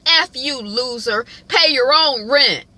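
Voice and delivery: high, loud jeer, slowing at the end; hammed-up taunt, drawing out the last words; mock insult. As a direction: act out a roommate's angry note. Roommate's insult scrawled on headshot.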